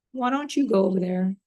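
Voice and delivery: ordinary talk, nothing marked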